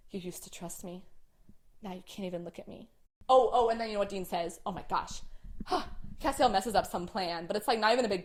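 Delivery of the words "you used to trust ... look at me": soft, wounded quiet voice; quiet hurt. Retold or imitated. Divorce kitchen fight, Castiel.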